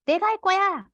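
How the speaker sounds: put-on high-pitched insistent child voice; mock toddler declaring I'll do it; child's demand. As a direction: mimic a toddler insisting on doing it himself.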